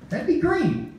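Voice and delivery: usual speaking voice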